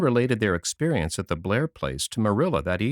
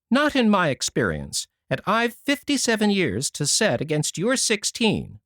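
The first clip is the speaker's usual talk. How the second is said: higher, tart comeback; tart retort; 7 semitones higher than usual. A narrator's reading. Eliza retorting to young Anne, tart and higher. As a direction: snap back with her years. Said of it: "retorted Eliza".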